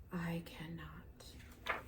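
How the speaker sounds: whispered, slow and trailing off; old engine's last whispered refusal; fading whisper. A narrator's reading. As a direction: let it die away to a whisper.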